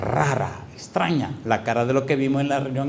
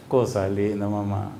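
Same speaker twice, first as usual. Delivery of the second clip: soft and moved; 6 semitones lower, 5 dB quieter, slower than usual. Real, not acted. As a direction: lower and softer, tender awe. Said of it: "pastor moved, soft". (con ternura, en voz baja y conmovida)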